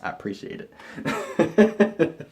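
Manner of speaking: laughed through, very slow; slow, breathy laugh over the words. Real, not acted. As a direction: giggle the words out. Laughing at own Jesus joke.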